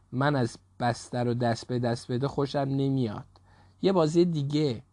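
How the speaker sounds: usual speaking voice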